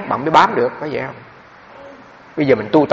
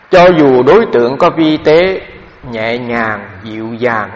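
slow, measured reading of a dharma passage, louder at the start; formal text reading; 4 dB louder, much slower than usual. Acted. The teacher reads a meditation text aloud to the assembly, slow and measured. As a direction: read every word deliberately, as if from the book.

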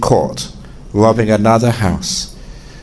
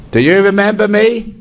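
burglar voice; 10 semitones higher than usual. Acted. Burglar returns at night.

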